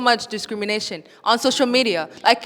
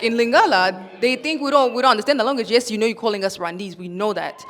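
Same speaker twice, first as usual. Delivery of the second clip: normal talking voice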